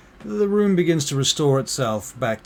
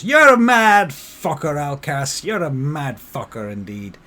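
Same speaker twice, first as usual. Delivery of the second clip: exclaiming barkeep; 5 semitones higher, 11 dB louder than usual. Acted. Barkeep admiring a mad brawler, loud exclaiming. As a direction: holler it, stretching the first words.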